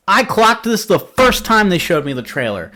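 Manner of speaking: high, heated rant; infuriated, high-pitched complaint; real exasperation. Real, not acted. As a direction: let the outrage push your pitch way up. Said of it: "That's infuriating".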